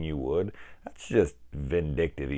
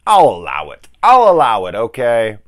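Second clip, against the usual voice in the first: loud, punchy mock proclamation; mock ruling; 5 semitones higher, 14 dB louder than usual. Reviewer grudgingly letting the character off, loud mock ruling. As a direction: declare it loudly like a verdict, climbing on the repeat.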